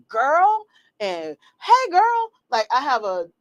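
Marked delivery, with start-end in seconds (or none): 0.1-0.6 put-on high Southern-style "girl"; imitated Southern greeting. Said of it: "the people that be like". 1.6-2.3 high, loud Southern-style hail; imitated Southern greeting. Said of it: "my Southern people in the South".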